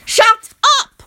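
high, loud yell; furious shout at Victor. A narrator's reading. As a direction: bark it to shut him up. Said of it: "I yell".

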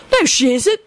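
high, petulant contradiction; mildly huffy objection; cross. A narrator's reading. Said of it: "Sirius chimed in crossly".

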